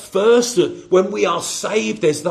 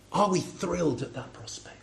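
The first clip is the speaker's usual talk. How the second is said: whisper; 4 semitones lower, 11 dB quieter than usual. Real, not acted. Pointed question to congregation.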